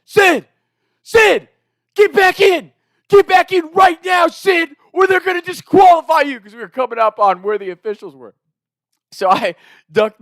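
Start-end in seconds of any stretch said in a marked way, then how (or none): 0.0-6.4 high, loud urgent shout; screaming at teammate. Retold or imitated. Teammate screaming across the course, urgent loud shout. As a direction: yell him back onto the course. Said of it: "And he's screaming".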